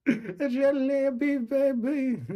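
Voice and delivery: high, loud giggling squeal; giggly tease. Real, not acted. Vlogger teases herself, squealing with laughter.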